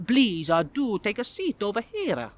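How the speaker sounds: Italian head waiter, stretched words; Italian accent. Acted. Italian head waiter seating a late guest, accented. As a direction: usher them over in an Italian accent. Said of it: "Obviously Italian".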